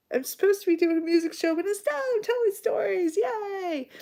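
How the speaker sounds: very high cheery squeal; mock cheer. Real, not acted. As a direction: chirp it like a cheerleader. Mock celebration.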